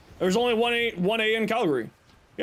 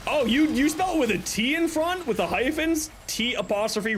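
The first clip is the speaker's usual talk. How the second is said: ordinary talk, nothing marked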